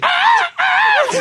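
loud, very high "ah, ah" squeal; high squealed shout. Retold or imitated. Showing what the chubby hype man does, high squeal. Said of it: "¿y el gordito ya qué hace?".

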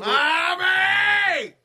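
loud high call across the room. Real, not acted. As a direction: shout for mom, high and loud. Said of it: "Llamando a mi mamá".

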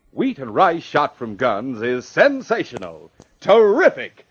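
loud, high announcer's hype; excited yelling. Acted. Announcer carried away selling cereal, yelling. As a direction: belt out the sales pitch, building to a high shout. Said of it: "stop yelling into me".

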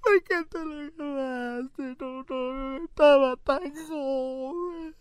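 high, slow hammed-up lament; mock wailing. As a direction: wail it like a grieving widow. Acted widow wailing continues.

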